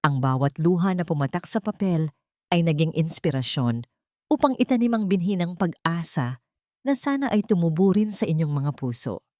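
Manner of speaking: usual speaking voice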